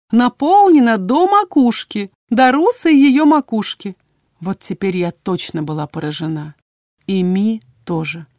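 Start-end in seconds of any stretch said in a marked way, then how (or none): 0.1-4.0 higher, louder, slowing singsong; rhapsodic healer. A narrator's reading. Healer rhapsodises over patient's magic, singsong delight. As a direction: gush with swooping delight.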